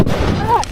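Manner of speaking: high cry of pain. Real, not acted. Wounded cry.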